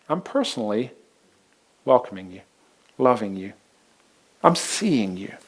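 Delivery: low, soft and gentle; Jesus tenderly welcoming Mary. Acted. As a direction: speak it close and kind.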